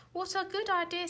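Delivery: high, eager exclamation; Zog's happy cry; eager delight. A narrator's reading. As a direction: jump at the idea. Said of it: "said Zog".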